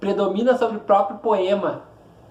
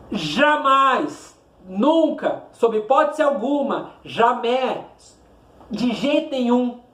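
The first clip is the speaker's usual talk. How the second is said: JAMAIS and NUNCA pitched high and drawn out, each set apart; emphatic denial; 4 semitones higher, slower than usual. Real, not acted. Teacher warns pupils about stress placement, emphatic JAMAIS. Teacher hammering home an absolute rule.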